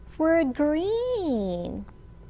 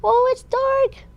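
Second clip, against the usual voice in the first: much higher, louder "oh" of pretend surprise; mock surprise; 12 semitones higher, 4 dB louder than usual. Shown as a demonstration. Storyteller shows children a high, startled "oh, it's dark". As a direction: act startled by the dark for the kids. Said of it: "Cover your eyes".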